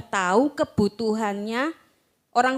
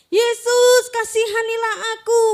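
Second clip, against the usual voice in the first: high, loud desperate cry; shouting; 11 semitones higher, 9 dB louder, slower than usual. Acted. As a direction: shout for Jesus over the crowd. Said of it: "Dia teriak seperti itu".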